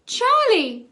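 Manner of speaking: high squeaking cry of alarm. A narrator's reading. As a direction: squeak his name in panic. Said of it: "squeaked Sharon".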